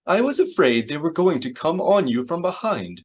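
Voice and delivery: high-pitched, anxious droid voice; C-3PO fretting. A narrator's reading. Droid frets to Han about an attack from behind, high and anxious. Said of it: "he exclaimed".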